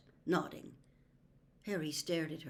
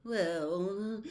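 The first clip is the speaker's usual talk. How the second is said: higher, slow, caught-out stammer; flustered seer; 3 semitones higher than usual. A narrator's reading. Trelawney caught out by Harry, flustered and evasive. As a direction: stall like a guilty prophetess.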